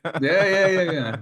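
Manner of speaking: high, laughing yeah yeahs; laughing yes. Real, not acted. Guest laughs off host's compliment, laughing. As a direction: chuckle through the agreement.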